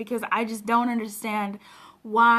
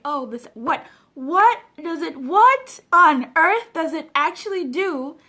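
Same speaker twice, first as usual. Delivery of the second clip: louder, drawn-out indignant question; mock exasperated outburst; 8 dB louder, slower than usual. Mocking mourners who show up too late, loud and indignant. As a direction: demand it like you can't believe it. Mocks people's late tributes.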